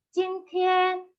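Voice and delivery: jintian, both syllables high and level; Mandarin tones. Shown as a demonstration. Teacher reading an example: jintian high and level. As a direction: hold jintian high and flat.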